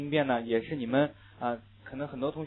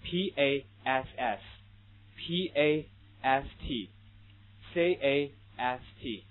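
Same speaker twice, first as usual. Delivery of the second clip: spelled out slowly; 3 dB quieter, much slower than usual. Shown as a demonstration. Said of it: "举个你们都非常熟悉的例子".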